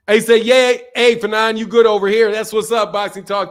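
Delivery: ordinary delivery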